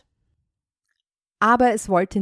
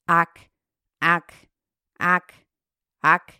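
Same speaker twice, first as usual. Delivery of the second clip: loud frog croaks; 5 dB louder, slower than usual. Acted. Frogs croaking on and on, louder. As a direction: croak out insistently. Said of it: "schrien immerfort".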